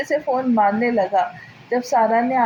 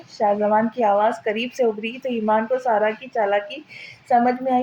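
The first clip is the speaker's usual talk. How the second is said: usual speaking voice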